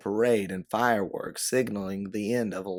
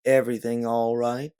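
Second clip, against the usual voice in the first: husky drawl; 3 semitones higher, 5 dB louder than usual. A narrator's reading. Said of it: "a husky drawl comes from behind".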